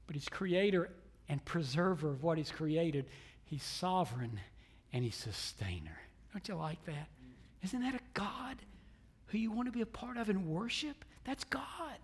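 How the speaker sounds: very soft, reverent pulpit voice; quiet, intimate sermon delivery; hushed preaching. Real, not acted. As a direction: let it sink in almost under the breath.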